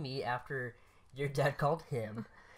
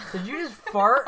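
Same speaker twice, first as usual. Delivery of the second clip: loud, higher startled question; surprised; 7 semitones higher, 9 dB louder than usual. Real, not acted. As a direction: blurt it out in shock. Sudden aside, louder.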